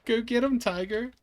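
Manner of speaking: high, loud teasing encouragement; playful cheer. Acted. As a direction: cheer him on like a proud coach. Cheering friend into game.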